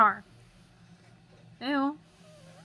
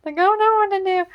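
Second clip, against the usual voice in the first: high, loud panicked cry; retelling her own frantic panic, high and loud; 9 semitones higher, 8 dB louder than usual. Retold or imitated. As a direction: blurt it out in total panic. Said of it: "And I'm like".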